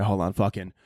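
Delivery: normal talking voice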